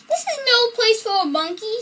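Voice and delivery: a light read-aloud yell at a monkey; mild yell. A narrator's reading. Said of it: "he yelled".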